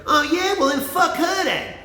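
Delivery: high, loud, whiny mimic of the guy; mocking high guy voice. Mocking the guy who got turned down, high and loud. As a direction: squeal it like an indignant jerk. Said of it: "Like that".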